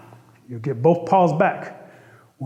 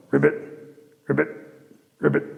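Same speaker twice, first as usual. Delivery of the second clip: frog ribbit; 2 semitones lower, much faster than usual. Acted. Frog call after Bullfrog's speech.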